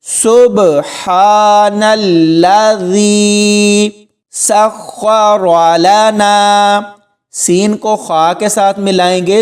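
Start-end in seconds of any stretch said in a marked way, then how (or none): 0.1-6.8 very slow سبحان الذی سخر لنا, long vowels held on a level tone; tajweed recitation. Shown as a demonstration. Teacher reciting the travel dua for learners, slow and sustained. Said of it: "کھینچ کر پڑھیں گے".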